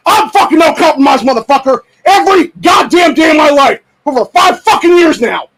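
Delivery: shouting his anger at the gun groups; furious shout. Real, not acted. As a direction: blast them with real rage.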